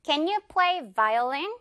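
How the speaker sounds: slow question, buzzing v in "violin"; clear V demo. Shown as a demonstration. Teacher modeling question for a friend, clear V. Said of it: "이렇게".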